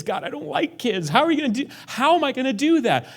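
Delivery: high, loud whining objection; whiny complaint. Retold or imitated. Believer objecting to God's call, whining high and loud. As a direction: whine like a kid dodging a chore.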